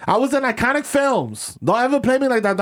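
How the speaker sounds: mock diva rant. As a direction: play an offended legendary actress. S1 plays diva actress.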